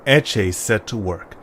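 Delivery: low, even storyteller reading; story narration. A narrator's reading. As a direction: read it like a bedtime storyteller. Narrator reads story opening.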